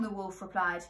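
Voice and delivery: ordinary delivery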